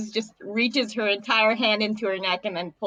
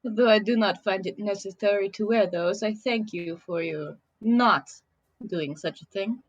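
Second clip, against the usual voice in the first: put-on stilted, deliberate Russian-accented speech. Merfolk medic politely declining a donation, Russian accent. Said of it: "The accent".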